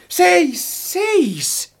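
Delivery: high-pitched cry of alarm; horrified shout. A narrator's reading. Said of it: "huusin kauhuissani".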